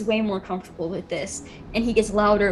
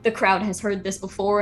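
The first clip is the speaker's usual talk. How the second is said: usual speaking voice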